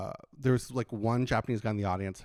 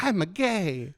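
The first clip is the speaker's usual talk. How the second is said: put-on Tokyo accent; 7 semitones higher, 4 dB louder, slower than usual. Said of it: "You're a famous clown in Japan".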